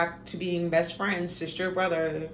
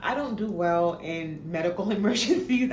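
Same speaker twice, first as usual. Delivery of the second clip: ordinary talk, nothing marked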